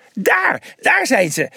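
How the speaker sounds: high, excited call. A narrator's reading. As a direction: call out the sighting urgently. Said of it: "zei hij opgewonden".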